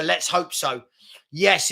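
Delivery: normal talking voice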